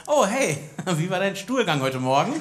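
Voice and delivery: high-pitched, mock-cheerful greeting; richtig fröhlich. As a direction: chirp it like a hammed-up, overly happy hello. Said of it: "so richtig fröhlich so". (übertrieben fröhlich, hohe Stimme)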